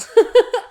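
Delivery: a high, loud burst of laughter through tears; teary laugh. Real, not acted. Laughing to herself so she doesn't cry on camera.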